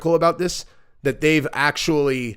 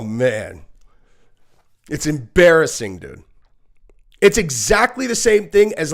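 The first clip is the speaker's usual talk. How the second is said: ordinary talk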